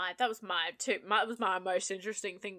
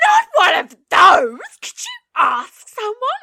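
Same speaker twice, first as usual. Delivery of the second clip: high, loud, slow yell of a frustrated customer; angry customer shout; 11 semitones higher, 18 dB louder, slower than usual. Retold or imitated. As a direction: shout it like she's fed up with you.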